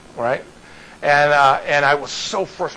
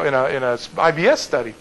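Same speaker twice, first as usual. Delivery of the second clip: normal talking voice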